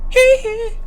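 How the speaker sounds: very high, very loud squeal. Pretend. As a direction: shriek it like the pop star. Sounds like [name].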